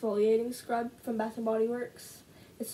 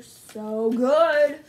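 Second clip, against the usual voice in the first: drawn-out, louder, savouring every word; gushing delight; 11 dB louder than usual. Real, not acted. Vlogger raving to viewers about her pretzel crisps, drawn-out loud delight. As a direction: rave about them like you can taste them. Gushing over snacks in a haul.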